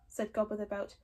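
ordinary delivery, nothing marked

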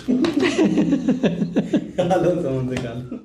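soft laugh at himself. Real, not acted. Presenter laughing at his own blooper, soft laugh. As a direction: break up over the take.

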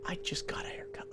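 soft unvoiced reply; whisper. Real, not acted. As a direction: whisper it back.

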